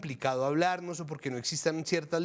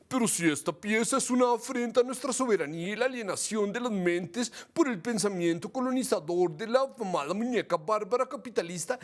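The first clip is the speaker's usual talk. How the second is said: higher, put-on voice of a leftist militant; mock mamerte activist; 3 semitones higher than usual. Host mimics the mamertes objecting to the video, mock activist voice. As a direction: parody an activist's outraged declamation. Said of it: "Porque les mamertes están todos".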